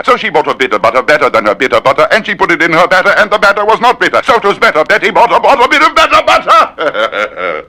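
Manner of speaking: fast, then a long high squeak; rushed climb to falsetto. Shown as a demonstration. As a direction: speed up and finish in falsetto.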